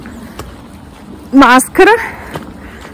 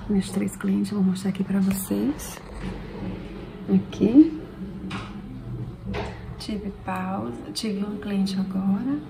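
whispering; 13 dB quieter than usual. Real, not acted. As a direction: whisper quietly at work. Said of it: "Tô falando baixo porque tem cliente".

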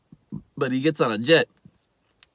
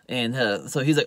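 ordinary talk, nothing marked

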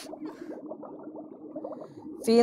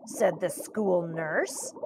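ordinary delivery

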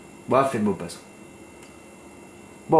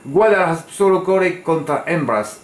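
higher, quicker Spanish accent, weight on "Guadalajara"; retry "Guadalajara solo corre contra hembras" with a more fluid Spanish accent; 4 semitones higher than usual. Shown as a demonstration. Tipster retrying his Spanish after losing his accent, Spanish accent. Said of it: "J'ai perdu mon accent".

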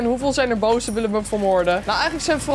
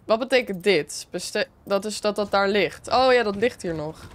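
normal talking voice

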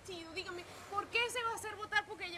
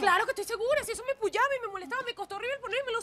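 louder, higher, indignant protest; upset insistence; 6 semitones higher, 8 dB louder than usual. Acted. Daughter snapping back at her mother, indignant and loud. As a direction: insist hotly that she's sure.

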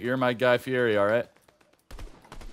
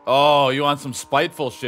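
mock offended; 6 semitones higher, 11 dB louder than usual. Real, not acted. Reacting to insult.

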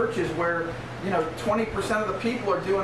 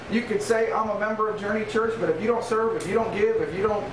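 normal talking voice

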